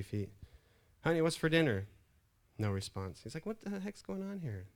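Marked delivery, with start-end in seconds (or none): 1.0-1.9 loud, high call to another room. Acted. Husband to wife in the kitchen, calling out. Husband calls again, closer. 3.5-4.7 high, softer baffled muttering; baffled husband. Acted. Husband getting no response from wife, baffled.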